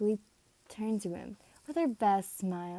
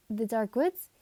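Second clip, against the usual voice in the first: nervous stammer, louder; stuttering; 7 dB louder than usual. Acted. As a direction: stumble over the words. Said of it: "Monoma stuttered out a bit".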